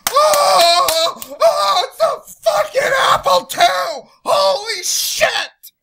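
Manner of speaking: high, loud, laughing shout of amazement; mock hysterical delight; ecstatic crowd. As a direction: gush like a stunned fanboy crowd. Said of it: "And everybody's like".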